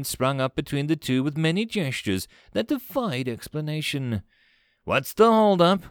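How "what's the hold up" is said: high, loud impatient demand; impatient snap. A narrator's reading. Commander fed up waiting on translation, impatient. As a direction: snap it out, louder and higher. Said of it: "Vakar snapped".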